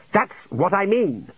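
high, drawn-out protest; exasperated insistence. Acted. Junior wizard insisting to his superior, exasperated. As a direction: stretch it out as proof of your point.

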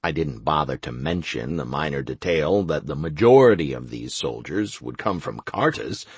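usual speaking voice

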